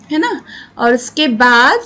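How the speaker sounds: ordinary delivery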